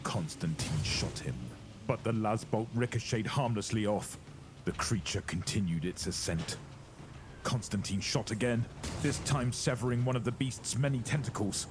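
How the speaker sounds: ordinary delivery, nothing marked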